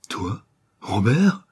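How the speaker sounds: slow, stricken disbelief; a restrained cry of despair, drawn out. A narrator's reading. Old miller recognizing his criminal son, slow understated despair. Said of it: "en un cri de désespoir".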